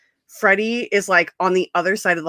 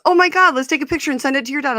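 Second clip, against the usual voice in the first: excited; 8 semitones higher, faster than usual. Retold or imitated. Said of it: "And he goes".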